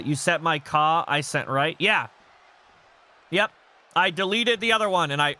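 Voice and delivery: normal talking voice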